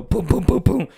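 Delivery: booms rising high; knocking sounds. Retold or imitated. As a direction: pound like knocking on a door. Imitating knocking on the door.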